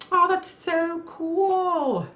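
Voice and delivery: high, slow, drawn-out gasp of delight; delighted awe. Real, not acted. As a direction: melt over something lovely. Sees tattoo photo, delighted awe.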